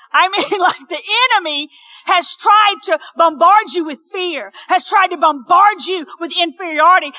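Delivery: loud, high, driving sermon delivery; full-force preaching. Acted. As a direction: preach with mounting urgency and punched key words.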